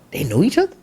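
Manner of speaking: puzzled, as if thinking it aloud; incredulous inner question. Retold or imitated. Acted bewildered thought in story.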